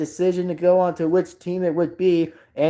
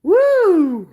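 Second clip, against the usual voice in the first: loud, high exhaling whoop; 12 semitones higher, 5 dB louder than usual. Real, not acted. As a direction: let out a big woo. Winding down after laughing.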